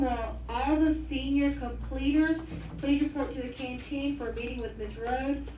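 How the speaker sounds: ordinary talk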